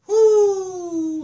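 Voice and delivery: high, loud shout of delight; excited whoop. Real, not acted. Preacher fired up by the verse, loud whoop. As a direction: let out a whoop of joy.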